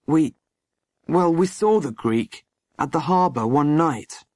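halting start with pauses; hesitant. A narrator's reading. Boy confessing to the sergeant, hesitant. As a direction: begin haltingly, then confess. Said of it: "began hesitatingly".

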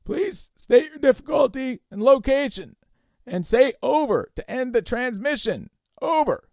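loud, high operator over static; radio voice breaking up. Acted. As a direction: transmit instructions through a poor signal. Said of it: "The voice was very faint and breaking up".

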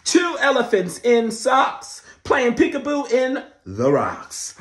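loud, high dramatic read-aloud; excited read-aloud; storybook reading. A narrator's reading. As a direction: announce it like big news to kids. Reading the book.

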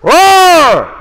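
high, very loud mock lion roar. Shown as a demonstration. Coach showing the kids a lion roar, loud playful roar. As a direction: roar like a lion to show how.

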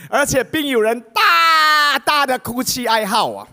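high, loud, drawn-out telling of the chaos; stretched-out cries of a chaotic house; dramatic uproar. Acted. Describing the chaotic mourning crowd.

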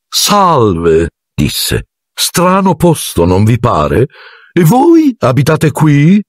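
a man who has forgotten everything, pleasantly vague; amiable Lockhart. A narrator's reading. Lockhart greets the boys, slow, high and dreamily friendly. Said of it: "con aria amabile".

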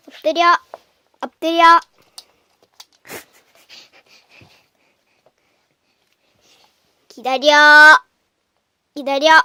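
firm loud commands; loud dog commands. Real, not acted.

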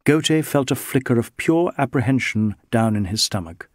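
plain reading voice

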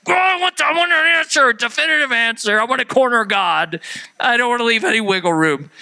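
high, whining mock desperation; driven crazy. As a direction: demand it like a frantic doubter. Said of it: "it just drives you crazy".

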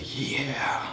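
soft mocking moan of "Yeah"; imitated breathy moan. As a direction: whisper the word "Yeah" back as a mock moan. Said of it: "Why are you moaning?".

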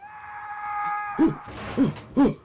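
sharp high scream of fright; loud terrified gasp. Real, not acted. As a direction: gasp in real terror. Jump scare in horror game.

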